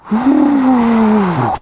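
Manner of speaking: high, long whooshing wind; howling wind. A narrator's reading. The wind blows round the cottage, a high howl. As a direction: imitate a gust with a drawn-out hoot. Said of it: "Blew the wind".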